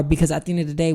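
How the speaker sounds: ordinary talk, nothing marked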